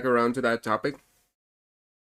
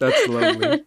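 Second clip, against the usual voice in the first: gushing; 4 dB louder than usual. Real, not acted. Gushing over a small gift.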